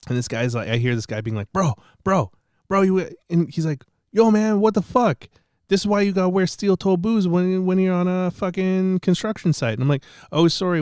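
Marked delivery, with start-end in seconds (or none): 1.5-9.7 high, loud mock worker telling him off; acted-out loud, high-pitched scolding; scolding construction guy. 10.3-10.8 loud, raised-pitch sarcastic sorry; acted-out indignant apology; mock apology. Acting his retort to the scolding.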